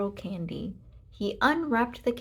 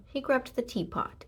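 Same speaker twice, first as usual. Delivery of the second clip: ordinary delivery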